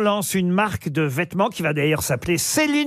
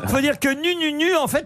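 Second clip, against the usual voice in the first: laughing through the words, pitched up; 7 semitones higher than usual. Real, not acted. Host laughing as he explains Nununu, laughing. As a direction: giggles while explaining the name.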